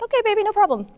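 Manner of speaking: put-on high, light "okay baby"; breezy reassurance. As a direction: soothe him, lightly.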